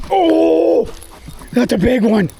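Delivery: sudden high oh, thrilled; excited. Real, not acted. Angler hooking his biggest fish, high and thrilled. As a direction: shout of delight at the catch.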